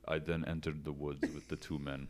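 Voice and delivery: low, soft somber German narration; Werner Herzog impression. Mocking a Herzog documentary, low and soft German narration. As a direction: intone it like a dark documentary narrator. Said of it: "he'd be like".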